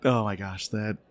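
ordinary talk, nothing marked

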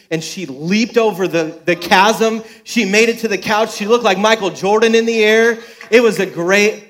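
dad cheering his daughter's big jump; excited build. Real, not acted. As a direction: tell the leap like a sports call. Excited story climax.

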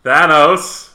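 "Thanos" high and loud, short "a"; loud, rising "Thanos"; mispronounced name. Pretend. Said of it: "You still said it wrong".

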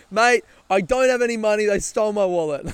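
loud, high, pleading protest; exasperated protest. Acted. Robbed man turning down the lady's hard sell, exasperated protest. Imagined protest at a sales pitch.

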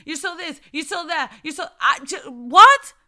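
normal talking voice

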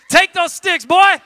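loud, playful call to the drummer; loud cheering shout; hype call. Real, not acted. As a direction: pump him up from the stage. Hyping the drummer on stage.

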